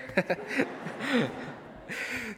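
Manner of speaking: high rising laugh; high giggle about the nickname. Real, not acted. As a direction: let a bright laugh rise. Laughs recalling friend's nickname.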